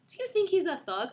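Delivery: high-pitched mock mom voice; mom impression. Mom sizing up daughter's short boyfriend, mock-wary. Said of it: "she'd be like".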